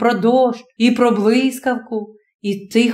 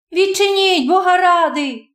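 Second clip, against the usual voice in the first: much higher, urgent plea at the door; a frightened girl begging to be let in; high, quick pleading call through the door; hurried plea; 7 semitones higher than usual. Acted. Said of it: "скорим голосом вимовив".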